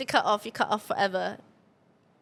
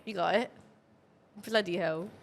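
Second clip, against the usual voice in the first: put-on lower, encouraging British accent; 4 semitones lower, 4 dB quieter than usual. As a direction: coach him along in British accent. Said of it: "Are you gonna do the accent?".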